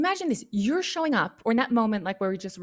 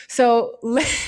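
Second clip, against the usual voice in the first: laughing; 6 dB louder than usual. Real, not acted.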